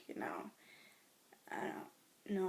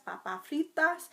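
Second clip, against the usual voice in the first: Spanish word; 7 semitones higher, 5 dB louder than usual. Shown as a demonstration. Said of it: "I know how to say French fries".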